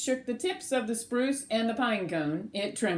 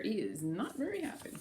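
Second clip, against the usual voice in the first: quiet; 10 dB quieter, faster than usual. Real, not acted. Aside about grumpy bear.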